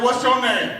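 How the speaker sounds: high, loud challenge thrown back; no punk. Retold or imitated. Younger sister challenges her big cellmate, high and mock-tough. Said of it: "I wasn't no punk".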